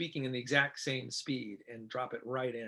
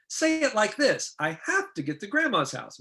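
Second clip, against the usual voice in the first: demonstrated line reading; 7 semitones higher, 4 dB louder than usual. Shown as a demonstration. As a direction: act out giving an actor a line reading. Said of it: "which is a line reading".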